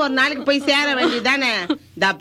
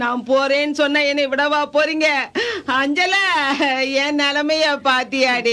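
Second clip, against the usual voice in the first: tearful wailing. Acted. Grandmother calls on dead Anjala to see her plight, wailing. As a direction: cry out the name, then wail. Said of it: "அழாதீங்கம்மா".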